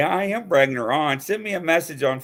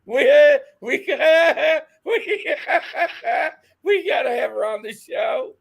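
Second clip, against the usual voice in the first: high, broken starts through laughter; laughing too hard to get it out; helpless laughter; 11 semitones higher than usual. Real, not acted. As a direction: keep restarting as laughter takes over. Cracking up, can barely speak.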